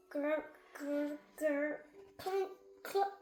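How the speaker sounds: mechanical grr and clunk sounds; robot walk noises. Acted. Ben and Barnaby doing a funny robot walk, clunking.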